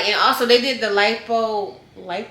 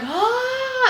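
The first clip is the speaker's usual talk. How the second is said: high held pained cry; a long high groan of pain; mock scream; 14 semitones higher than usual. Post-op patient crying out to doctor, high held cry.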